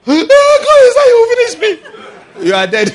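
very high, loud, hammed-up wail to God; mock wailing prayer. Preacher mocks kneeling and wailing to God at home. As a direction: overact a desperate complaint.

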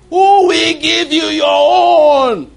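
slow, high, loud and drawn out; preaching shout. Acted. Preacher crying out the scripture to his congregation in a slow, high shout. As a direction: stretch every word in a rising preaching cry.

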